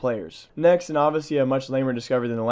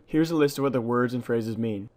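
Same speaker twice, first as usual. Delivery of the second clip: ordinary talk, nothing marked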